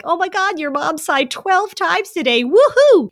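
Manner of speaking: high, excited squeal, loud on the woohoo; gleeful wife reporting sighs; thrilled cheer. Retold or imitated. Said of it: "she'd be like".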